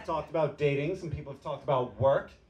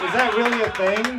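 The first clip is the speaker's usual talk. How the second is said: higher and louder, baffled question; incredulous; 7 semitones higher, 7 dB louder than usual. Real, not acted. A worker baffled by matrix corporation jargon asks, incredulous. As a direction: ask it in real disbelief.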